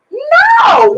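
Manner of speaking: high, loud, drawn-out shout of protest; real high-pitched emphatic yell; emphatic denial. Real, not acted. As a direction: cry it out in real disbelief. Emphatic denial in lively debate.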